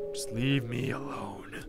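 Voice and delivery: slow, low breath of a whisper; withdrawn whisper. Acted. Character telling others to forget him, withdrawn whisper. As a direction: whisper it slowly, drawing the words out.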